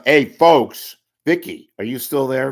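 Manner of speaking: ordinary talk, nothing marked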